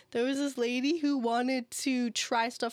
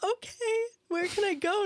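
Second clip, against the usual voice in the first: high mock customer; 10 semitones higher than usual. Customer quoted.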